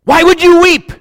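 high, loud anguished cry; pleading cry. Acted. Preacher voicing Jesus to Mary, high loud cry.

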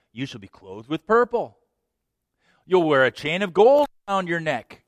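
fast, high, loud sales pitch; slick used car salesman. Acted. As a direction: hype the deal like a huckster. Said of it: "he sounds like a used car salesman".

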